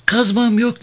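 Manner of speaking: put-on very high-pitched child's reply; young boy's voice. A narrator's reading. Hurşit answers the sergeant, in a high boy's voice. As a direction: answer as a small boy, high up.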